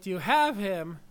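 imitated yell, only half full. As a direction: call it up to someone upstairs. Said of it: "they're yelling to him upstairs".

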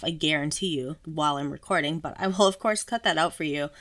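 normal talking voice

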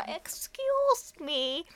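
slow, stretched, pleading excuse me; meek and dragged-out; drawn-out meek plea. Retold or imitated. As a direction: beg sheepishly for a ride. Repeating the plea to the stranger.

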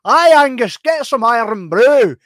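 loud, high, stretched-out broad Scottish caricature; hyped-up Scottish caricature. Retold or imitated. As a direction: ham up an over-the-top Scottish accent, slow on the last words. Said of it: "the hyped up Scottish person".

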